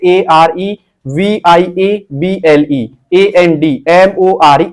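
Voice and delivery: measured spelling, one letter after another. Shown as a demonstration. Teacher showing typing students how to read, letter by letter. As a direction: spell each word out as separate letters. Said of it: "आपको पढ़ना है कैसे".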